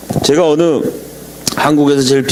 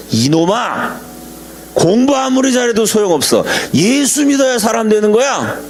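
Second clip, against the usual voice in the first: much higher, mock-stern shout of an old man scolding a student; scolding old president; 7 semitones higher than usual. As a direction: scold the young scholar like a fervent grandfather. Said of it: "소리를 지르더래요".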